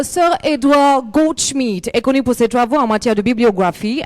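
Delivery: loud, declaiming presentation read; formal read-aloud. Acted. Linda reads the founder's history to the audience, loud formal reading. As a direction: read it out like an official speech to a hall.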